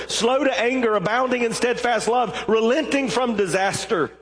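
high, pleading complaint to the Lord; angry prayer. Acted. Jonah complaining angrily to God in prayer. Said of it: "He was angry".

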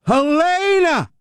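high, slow drawn-out name call; mock rally shout. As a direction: call her name like a campaign crowd-pleaser.

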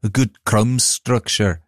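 slow mock Scottish accent; attempted Scottish accent. Shown as a demonstration. Co-host redoing the baking judge's line, rough Scottish accent. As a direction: have a slow go at Scottish, sliding towards Liverpudlian. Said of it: "I'm gonna do it in a Scottish accent".

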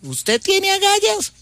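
slow, very high falsetto question at the end; little old granny. Acted. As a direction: pipe up like a polite little grandma. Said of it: "llega la abuelita y le dice".

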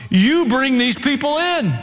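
high, slow, stressing you; emphatic charge. Acted. God charging Joshua, high and emphatic. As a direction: point the charge right at him.

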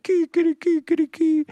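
high crowing wail; crying rooster. Acted. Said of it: "And the rooster said".

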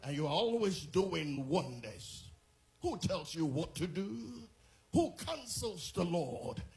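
loud, high, chanted praise; preacher at full force shouting praise; ecstatic preaching. Acted. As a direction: cry out the names of God at full force. Prayer climbs to a peak.

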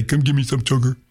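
lightly voiced coaxing dog; sweet dog voice. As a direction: coax like a cuddly pet. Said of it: "Scout can look at you and say".

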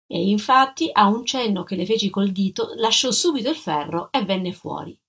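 ordinary delivery

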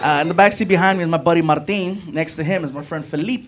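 ordinary delivery

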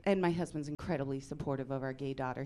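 ordinary delivery

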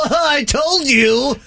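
high, slow squeaky voice. Retold or imitated. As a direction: squeak it out mockingly. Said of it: "Real squeaky voice".

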